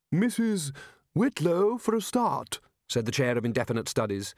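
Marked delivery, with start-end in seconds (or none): 0.1-2.6 much higher character voice; pointed interjection. Acted. The chair answers Ridcully's speech, pointed interjection. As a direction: put in the name high and emphatic.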